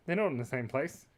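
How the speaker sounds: usual speaking voice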